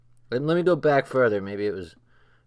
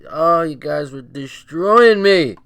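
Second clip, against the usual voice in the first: overwhelmed delight; 3 semitones higher, 9 dB louder, slower than usual. Real, not acted. Overcome by laughter at chat.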